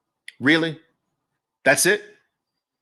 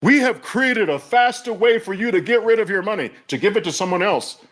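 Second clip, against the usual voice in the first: a lightly voiced mock sales pitch, higher; mock crypto pitch; 6 semitones higher than usual. Mocking the crypto promoters' bragging, lightly voiced pitch. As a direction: parrot the bragging marketing line with a touch of scorn. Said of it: "Think about what's being bragged about here".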